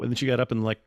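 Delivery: ordinary talk, nothing marked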